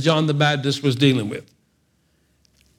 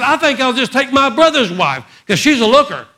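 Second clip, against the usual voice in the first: mock smug Herod; 9 semitones higher, 5 dB louder than usual. Herod voiced mocking, loud.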